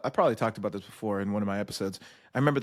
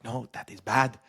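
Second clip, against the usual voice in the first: imitated African accent, firm disapproval; mock a stern recruit's African accent. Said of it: "I'm doing that accent".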